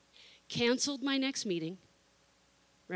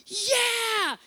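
put-on eager teens; 11 semitones higher, 6 dB louder than usual.

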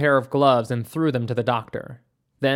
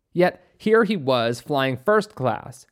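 ordinary delivery

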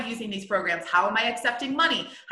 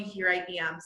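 normal talking voice

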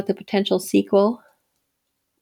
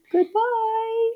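high, playful goodbye; sing-song goodbye; 14 semitones higher than usual. Real, not acted. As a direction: sing the farewell high and bright. Sign-off.

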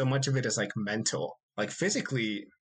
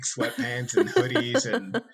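laughing; 8 semitones higher, 5 dB louder, slower than usual. Real, not acted. Laughs while listing clothes.

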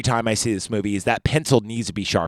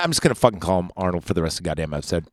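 normal talking voice

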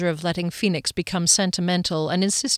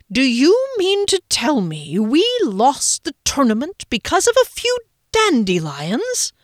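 high, loud disbelieving outburst; can't believe what he's hearing; incredulous outrage; 7 semitones higher, 5 dB louder than usual. A narrator's reading. Rickenbacker incredulous at Phoenix over the lost tournament.

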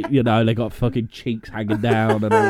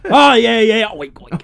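pig voice; 9 semitones higher, 9 dB louder, slower than usual. Pretend. Mocking a pig-human.

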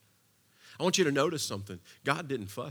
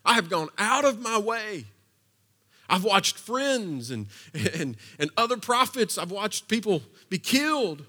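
higher, louder exasperated plea; complaining plea; 8 semitones higher, 8 dB louder than usual. Acted. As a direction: pour out a frustrated complaint to God. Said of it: "Elijah says".

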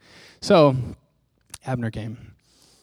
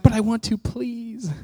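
high, small mock begging; pleading little spirit; 9 semitones higher than usual. Weak spirit begs the flesh to let it go, pleading. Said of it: "my spirit was like".

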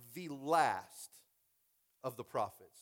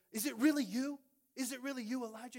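high-pitched, incredulous question; acted awe; 11 semitones higher than usual. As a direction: play astonished disbelief, pitched well up. Obadiah falls before Elijah, acted.

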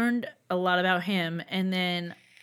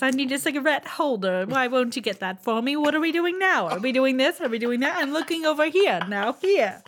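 put-on high, loud old-time radio starlet; old-timey radio voice; 5 semitones higher, 5 dB louder than usual. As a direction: play a glamorous old-time radio lady. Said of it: "I'm just gonna talk like this".

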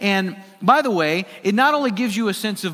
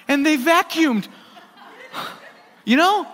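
laughing it out, higher and louder; bursting into delighted laughter; 9 semitones higher, 4 dB louder than usual. Real, not acted. Pastor tells congregation about his kids, laughing.

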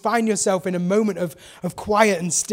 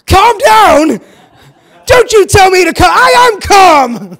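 high, very loud indignant shout; hammed-up stressed-out shriek; least calm voice; 11 semitones higher, 15 dB louder, slower than usual. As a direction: yell it in a mock outburst, protesting far too much. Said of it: "in the least calm voice".

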